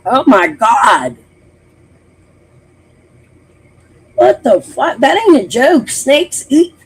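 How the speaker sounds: loud, rising disbelief, pitch climbing; shocked outburst. Real, not acted. Streamer reacts in disbelief to the snake story, loud. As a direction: blurt out your shock at the news.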